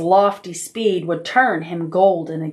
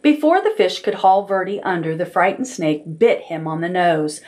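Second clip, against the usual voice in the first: ordinary delivery, nothing marked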